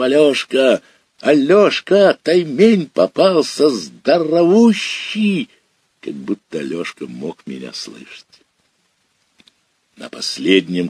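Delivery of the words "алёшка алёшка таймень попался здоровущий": much higher, louder calling shout, slower at the end; yelling at top of lungs. Acted. Boy calls to his brother on shore, full-throated shout. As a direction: bellow across the dark river. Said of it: "закричал в темноту во всю глотку".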